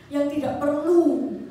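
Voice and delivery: ordinary delivery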